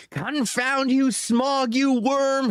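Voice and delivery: high, slow squeaky shout; squeaked. A narrator's reading. Hobbit taunts the hidden dragon, high squeak. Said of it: "he squeaked aloud".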